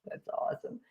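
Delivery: near-whisper, barely voiced; hushed aside. Real, not acted. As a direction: whisper it under your breath. Reading a viewer's comment aside.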